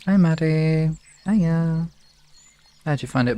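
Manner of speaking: ordinary talk, nothing marked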